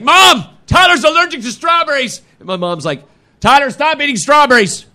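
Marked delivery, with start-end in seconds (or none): 0.0-2.2 high, loud urgent call; calling to mom. Retold or imitated. Boy calls to mom driving, high urgent call. 3.4-4.9 high, loud mock mother's scold; scolding mom. Mother scolds son from driver's seat, high mock scold.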